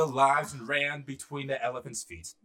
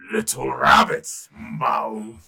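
low, loud, lumbering elephant king; big deep elephant voice; 6 semitones lower, 9 dB louder, slower than usual. Acted. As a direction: boom it down heavily at a tiny creature. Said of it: "he said in his elephant voice".

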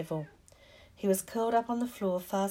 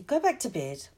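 mildly raised, angry order; furious; 3 semitones higher, 4 dB louder than usual. A narrator's reading. Ron sending Hermione back to bed, furious. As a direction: order her off crossly. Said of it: "said Ron furiously".

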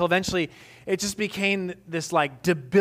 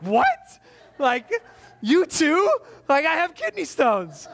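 mock incredulous; 9 semitones higher, slower than usual. Patient retelling reply to a back pain diagnosis, mock incredulous. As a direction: protest the diagnosis in exaggerated shock.

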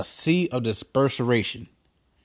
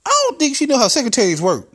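high loud scoff; Trump aside; 11 semitones higher, 6 dB louder, faster than usual. Acted. Aside in character.

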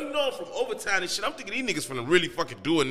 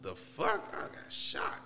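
whispered, shocked realization; stunned whisper; 10 dB quieter, slower than usual. Retold or imitated. Realizing he's been shot, stunned whisper. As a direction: breathe it out in disbelief. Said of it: "I'm like".

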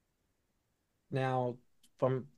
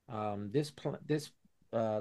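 normal talking voice

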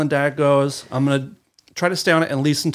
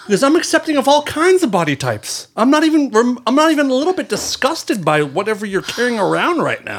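high, louder sarcastic generosity; mock magnanimous; 8 semitones higher, 5 dB louder than usual. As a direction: ham up his fake acceptance. Mocking the boyfriend's excuse.

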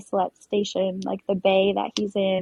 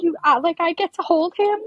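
amazed disbelief; 9 semitones higher, 5 dB louder than usual. Retold or imitated. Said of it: "And I was like".

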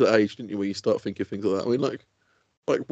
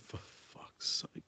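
slow, whispered venting to himself; hushed acted exasperation; exasperated whisper; 20 dB quieter, much slower than usual. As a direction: let it out under your breath, drawn out. Venting alone in car, acted.

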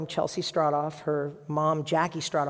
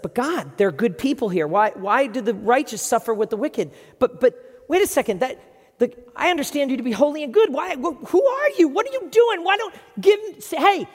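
much higher and louder, building to a shout; pleading cry; 9 semitones higher, 8 dB louder than usual. Acted. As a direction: cry out at God, demanding an answer. Habakkuk cries out at God.

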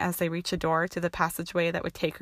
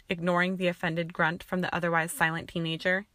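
usual speaking voice

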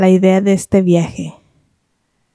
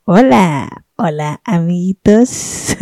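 put-on slow, sing-song greeting to children; kids show host; slower than usual. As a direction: greet the little friends like a TV host. Playful show opening greeting.

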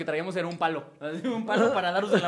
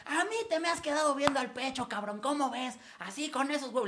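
confrontational complaint; 7 semitones higher, 6 dB quieter than usual. Retold or imitated. Student confronting the teacher who harassed her, higher-pitched retold reproach. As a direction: imitate her indignant challenge, voice lifted. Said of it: "Le empieza a reclamar".

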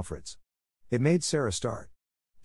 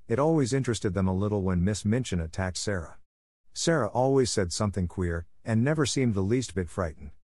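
ordinary delivery

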